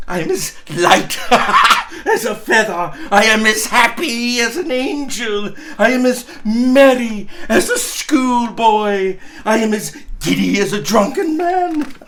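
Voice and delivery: laughing through the words like a giddy schoolboy. Acted. Scrooge to himself, laughing and crying at once. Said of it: "laughing and crying in the same breath".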